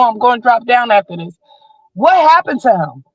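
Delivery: usual speaking voice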